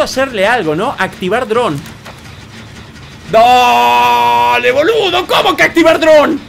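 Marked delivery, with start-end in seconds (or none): 3.3-6.4 exasperated yell. Real, not acted. Player baffled by the activate-drone prompt, exasperated yell. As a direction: yell in baffled frustration, pitch climbing.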